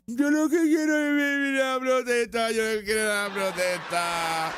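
high put-on whiny voice; mocking protester voice. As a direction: ham up a childish protester. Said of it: "se asfixian". (voz burlona de manifestante)

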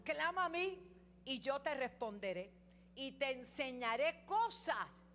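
high, loud scripture call, slowing at end; God's promise, ringing out. Acted. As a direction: proclaim it as God speaking. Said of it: "Pero ahí dice".

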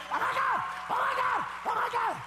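very high, loud squeals of fake terror; mock panicked shrieks. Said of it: "No te quedes diciendo".

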